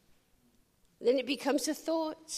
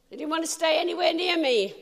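louder and higher, exultant; ringing triumphant declaration; triumphant preaching; 7 semitones higher, 8 dB louder than usual. Acted. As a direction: proclaim the victory.

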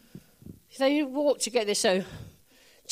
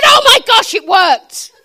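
much higher, much louder cry of amazement; excited shout; 9 semitones higher, 16 dB louder, slower than usual. Acted. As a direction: shout it like you can't believe it worked. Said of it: "He said".

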